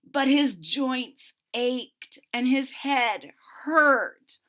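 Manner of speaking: usual speaking voice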